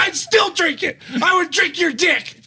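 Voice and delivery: joking shout. Real, not acted. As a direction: shout the joke back. Joking shout back at Joseph.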